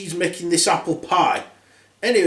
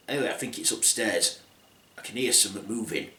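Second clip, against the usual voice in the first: hushed dwarf; 6 semitones lower, 9 dB quieter, faster than usual. Acted. Dwarf hearing noise upstairs.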